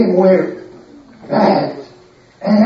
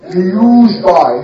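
usual speaking voice

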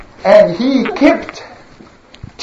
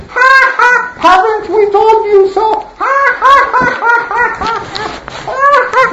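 jeering laughter; 13 semitones higher than usual. Acted. Crowd jeering at Noah, high mocking laughter. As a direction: laugh in his face.

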